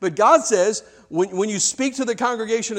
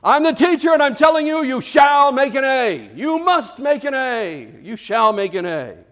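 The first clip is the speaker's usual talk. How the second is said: stern commanding teacher; 6 semitones higher, 5 dB louder than usual. Shown as a demonstration. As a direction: bark it down at the class like a tyrant. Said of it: "but he says it like this".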